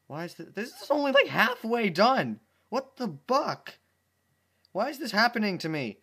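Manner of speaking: high, loud panicked thoughts. Acted. Dash panics over liking a fruit, high and loud. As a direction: freak out in your own head. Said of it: "she thought to herself".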